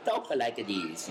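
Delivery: put-on slow, gentle old Italian accent; a kindly old Italian man, drawn out in an Italian accent; nice old Italian guy. Shown as a demonstration. Actor recalls playing Gremio, a slow, kindly Italian accent. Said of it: "nice old Italian guy".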